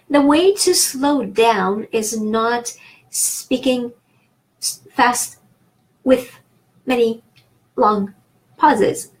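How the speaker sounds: halting, word-by-word with gaps; long pauses between words. Shown as a demonstration. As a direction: break it up with a long pause after each word. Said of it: "Did you see what I've done there?".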